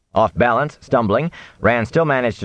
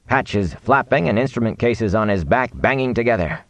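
ordinary delivery

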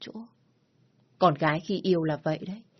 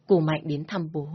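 plain reading voice

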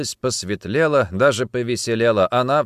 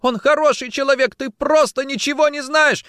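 very high, loud desperate defence; screamed; 5 dB louder than usual. Acted. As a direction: shriek it in his defence. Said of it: "закричала Варя".

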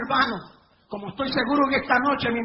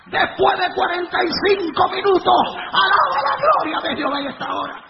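laughing exultant shout; 5 semitones higher, 7 dB louder than usual. Real, not acted. Preacher exults after the miracle.

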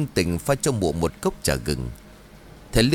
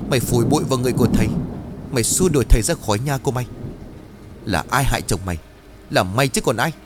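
furious scolding; 2 semitones higher, faster than usual. Acted. Chín scolding the greedy Trang, furious. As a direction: tear into her, spitting fury. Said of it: "nghiến răng rồi bảo".

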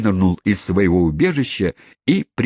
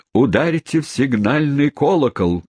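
raised-pitch mock shout; understated shout, pitched up; 6 semitones higher than usual. A narrator's reading. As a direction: mildly cry out an alarm. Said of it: "крикнуло несколько голосов".

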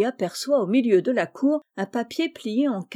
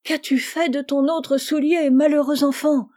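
raised, exasperated reproach; scolding; 6 semitones higher, 4 dB louder than usual. A narrator's reading. Mother questioning her son about the shoe, scolding. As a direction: scold on a high pitch, building louder.

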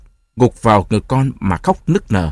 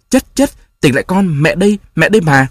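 higher, urgent mother's call; frightened calling; 6 semitones higher, 3 dB louder than usual. A narrator's reading. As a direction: call the child back in fear. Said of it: "sợ hãi, lay mạnh thân nó rồi gọi".

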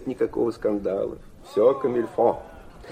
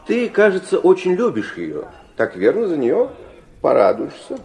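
teasing needle; 7 semitones higher, 4 dB louder than usual. Acted. Versilov needles his son.

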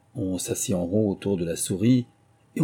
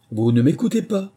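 high, louder scolding from the mouse; severe; 7 semitones higher, 6 dB louder than usual. A narrator's reading. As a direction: reprimand sternly, raising the voice. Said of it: "d'un air sévère".

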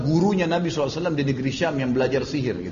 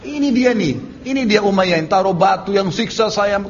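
high, loud accusation to a crowd; storyteller acting out a rallying denunciation; acted accusing cry; 6 semitones higher, 4 dB louder than usual. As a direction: point out the culprit, stretched and raised. Acted Bilal accusing his former torturer.

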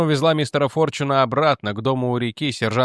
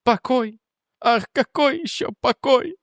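startled disbelief; 12 semitones higher, slower than usual. Acted. Woman startled, confused.

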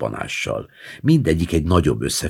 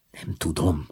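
softer, half whisper; 7 dB quieter than usual. A narrator's reading. Child whispers his doubt to grandma, whispered. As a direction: hush it out.

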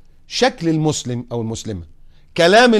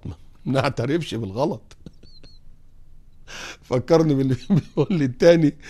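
ordinary delivery

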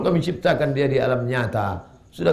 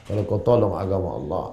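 ordinary talk

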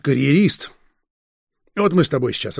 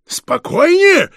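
much higher, louder, slow indignant echo; raised voice; 7 dB louder, much slower than usual. A narrator's reading. Detective indignant at the evasive suspect, raised voice. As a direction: throw the word back, raising the voice. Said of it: "повысил голос фон Шпинне".

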